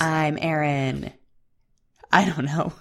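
worn out, hoarse late night voice. Real, not acted. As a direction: voice a little shot. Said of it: "my voice is a little shot right now".

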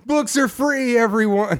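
high, giddy mock-drunk announcement; shit-faced drunk. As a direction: play a drunk giving everything away. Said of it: "so shit-faced that you're just like".

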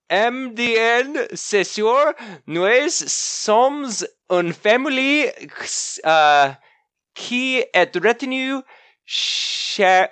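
put-on halting high French with an American accent; sassy high French welcome, stumbling; broken French. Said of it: "A bunch of really broken French".

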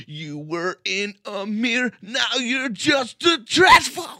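slow, rising to a high scream; screamed lyric. Acted. Co-host demonstrating a sweet lyric, high screamed delivery. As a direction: build from drawn-out words to a full metal shout.

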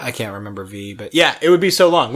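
normal talking voice

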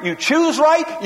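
ordinary talk

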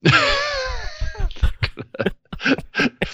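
shrieking burst of laughter; high squealing laugh. Real, not acted. Laughs at shark joke.

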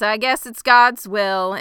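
loud, slow mock-pious dig; imitated shade. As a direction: draw it out loudly with pointed disdain. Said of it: "throwing some serious shade".

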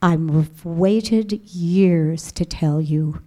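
low, very slow, solemn divine voice; voice of God. Retold or imitated. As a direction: speak as God to a daughter, low and weighty. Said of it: "And he said".

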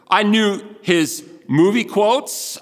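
ordinary talk

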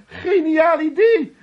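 high, slow, loud mock cheer; [name] imitating Frank's excited shout. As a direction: ham up Frank's enthusiasm. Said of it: "riep Frank".

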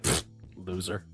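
soft, dismissive puff and put-down; dismissive scoff. Acted. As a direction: scoff quietly, like a snooty local. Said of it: "they're like".

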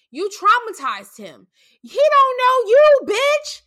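angry shout. Real, not acted. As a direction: yell it at her, outraged. Angry rant at the woman.

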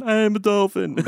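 half-hearted dolphin voice; bad dolphin impression. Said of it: "are you doing a bad impression of a dolphin".

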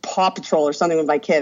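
ordinary talk

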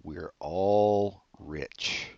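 usual speaking voice

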